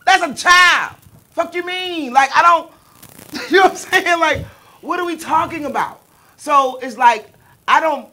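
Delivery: loud, high indignant outburst; outraged outburst. Real, not acted. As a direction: blurt it out in disbelief. Outraged reaction to marriage age.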